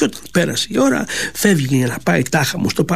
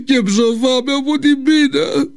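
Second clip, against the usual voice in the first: put-on wailing old man; 9 semitones higher than usual. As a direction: plead in a broken old voice. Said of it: "φώναξε". (γέρος που φωνάζει κλαψιάρικα στο βελονάκι)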